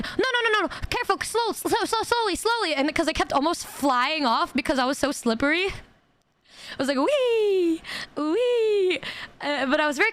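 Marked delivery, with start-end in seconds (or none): 0.0-2.7 high-pitched imitated alarmed warning; mock-urgent, high repeated cautioning. Spa worker warning slippery customer. 7.0-9.0 high, drawn-out mock glee; high playful whee; playful squeal. Acts out sliding while slippery.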